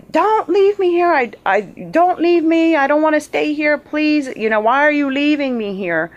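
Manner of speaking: high desperate screams, near tears; crying and screaming. Retold or imitated. Woman pleading to a departing craft, crying and screaming. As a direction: scream up at the light begging it not to go. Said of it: "I'm crying and screaming".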